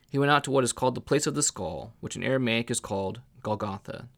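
plain reading voice

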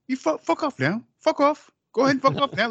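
imitating Johnny Quid. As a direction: toss him out like a cocky gangster. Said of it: "he's like".

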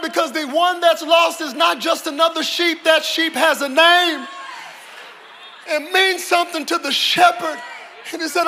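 loud, driving sermon climax; full-force preacher; preaching at full force. Acted. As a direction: preach it hard to the room.

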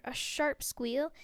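ordinary delivery, nothing marked

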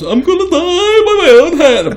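high, loud, wailing English caricature; hammed-up English accent, almost crying out; mock English accent. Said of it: "By that voice".

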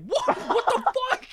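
laughing what the fuck; shocked disbelief. Retold or imitated. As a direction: squeal out the shock of the price. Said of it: "I was like".

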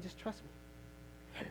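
whispered, slow and soothing; hushed divine reassurance. Acted. God answering the anxious believer, hushed reassurance. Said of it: "And God is saying".

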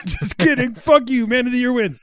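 high laughing echo, slowed; mocking laughing repeat.

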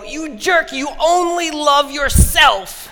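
high, loud mock scream; imitating a scream. Neighbor David screaming insults in the street, high mock yell. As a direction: shriek the insult like the angry neighbor. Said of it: "So he's screaming at me".

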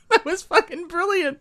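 thrilled and laughing; excited. Real, not acted. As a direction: burst out in delight. Delighted after partner's reading.

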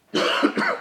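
harsh sudden coughing fit; choking cough. Real, not acted. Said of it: "Sorry, I'm choking".

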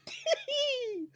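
sincere high gleeful laugh; a real burst of delighted laughter. Real, not acted. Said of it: "That was the most sincere laugh I've ever heard from him".

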